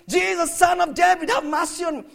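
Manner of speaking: high, loud repeated plea; insistent crying out. Acted. Blind beggar keeps calling Jesus despite the crowd, crying out. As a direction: call out over those hushing you. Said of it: "It was a continuous thing".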